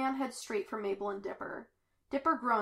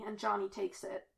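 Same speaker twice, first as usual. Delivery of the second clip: ordinary delivery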